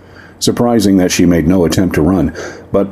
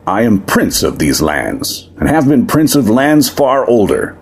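a lightly voiced proud demon prince, aggrieved; 7 semitones higher than usual. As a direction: speak as a wronged demon noble to the crowd.

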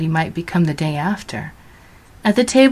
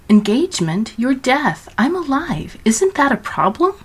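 incredulous protest; 7 semitones higher than usual. A narrator's reading. Georgina protests to Death.

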